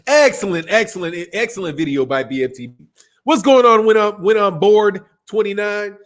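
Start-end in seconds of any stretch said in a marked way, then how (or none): none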